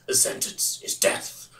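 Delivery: slow, low-key imitation of a cold lawman; a hint of a grim judge, dragged out; growly Judge Dredd voice. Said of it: "this very growly voice".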